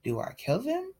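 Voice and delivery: stunned inner thought. Retold or imitated. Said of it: "And I'm just like".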